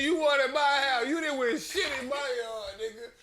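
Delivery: loud, high mock rant; hammed-up furious old man; angry homeowner. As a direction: chase them off the yard. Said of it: "He said".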